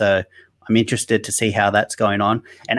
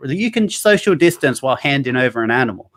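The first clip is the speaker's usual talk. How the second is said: usual speaking voice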